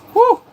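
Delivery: sharp, very high, loud "whew"; excited whoop. Real, not acted. Streamer thrilled about fantasy shows, high loud whoop.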